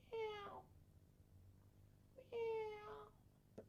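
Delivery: very high, soft feline meow; high soft cat cry from behind the wall; real cat meow. Acted. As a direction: sound exactly like a real cat. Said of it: "Like a real cat".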